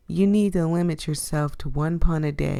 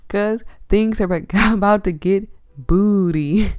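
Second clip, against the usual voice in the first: laughing; 4 semitones higher, 6 dB louder than usual. Real, not acted. Laughing while reading pun line.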